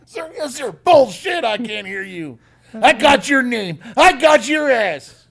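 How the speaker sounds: loud, high barking sergeant; drill sergeant. Retold or imitated. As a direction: yell insults like a drill instructor. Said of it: "drill sergeant impression".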